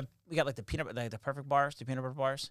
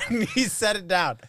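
normal talking voice

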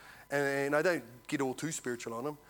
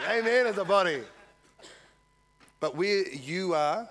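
ordinary delivery

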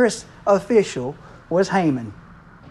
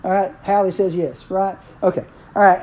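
usual speaking voice

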